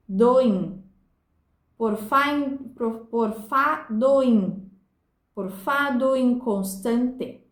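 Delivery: "do inconstante" with "do in" fused into one beat; slow beats, "doim" as one joined syllable; scanning by sound. Shown as a demonstration. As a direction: spell out "Por fa, doim" syllable by syllable. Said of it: "conta-se pela sonoridade".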